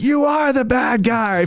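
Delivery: high, slow yelled accusation from the back; an angry woman yells at the developer; retold heckler yell. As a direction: shout it like a heckler in a council meeting. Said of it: "this lady yells".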